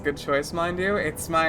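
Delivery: ordinary talk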